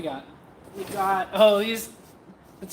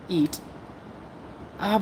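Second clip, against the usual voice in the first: ordinary delivery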